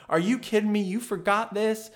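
high, incredulous telling-off; mock snapping wife. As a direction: imitate an old lady scolding her husband. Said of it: "just snaps on her husband".

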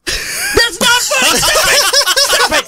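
high-pitched outburst through laughter; laughing, worked-up objection; exasperated protest. Real, not acted. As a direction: protest loudly, pitch jumping way up. Heated mock protest at fart gag.

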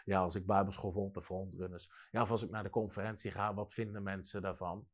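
mock the nervous excuse of someone afraid of criticism; timid worrier. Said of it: "maar ze zijn bang voor kritiek".

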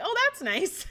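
high-pitched pleased reply; amused delight. Retold or imitated. Patient hears her bladder helped out, amused delight. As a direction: pitch it way up, about to laugh.

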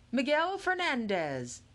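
slow, loud announcer call; lightly voiced emcee; announcing names. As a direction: call the winner like on a stage. Calling winners to stage.

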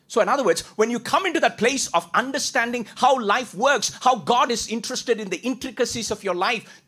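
high, loud preaching build; fervent preaching. Acted. Preacher urging the congregation, loud and high. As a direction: drive the point home to the congregation.

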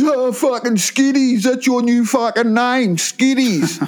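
hammed-up biker handing out a nickname; biker naming him.

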